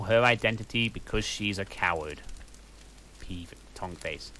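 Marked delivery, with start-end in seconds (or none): none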